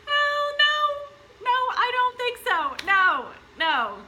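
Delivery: high, loud "oh no, no"; emphatic refusal. Acted. Mother rejects the idea of dropping her baby, loud and high. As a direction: shoot the idea down hard.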